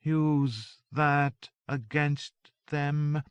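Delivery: very slow, drawn-out droning. A narrator's reading. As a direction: drone each word out slowly. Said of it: "Joan droned".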